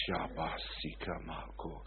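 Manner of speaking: slow, soft speaking in tongues; a quiet burst of tongues. Retold or imitated. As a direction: murmur a tongues phrase slowly. Praying in tongues.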